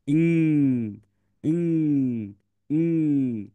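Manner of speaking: ইং said slowly and clearly, ending in ng; sound drill. Shown as a demonstration. Teacher drilling the ইং sound for children. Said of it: "তাহলে এরকম হয় সাউন্ডটা".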